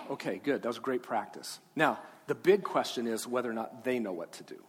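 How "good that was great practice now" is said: normal talking voice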